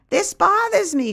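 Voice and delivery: put-on high-pitched elderly neighbor, bothered; Bertie, troubled. A narrator's reading. Bertie shows her neighbor the strange form, troubled. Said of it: "Bertie greets me at the door with a troubled face".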